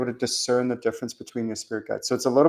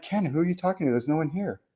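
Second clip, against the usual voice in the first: higher, softer self-talk; mock puzzled aside to himself; questioning himself; 5 semitones higher, 5 dB quieter than usual. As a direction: gently call yourself out. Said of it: "I'm like".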